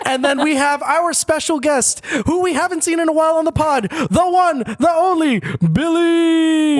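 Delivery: high, drawn-out announcer build; grand guest introduction. Pretend. Host presenting the special guest, theatrical hype. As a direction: build up to the name and stretch it.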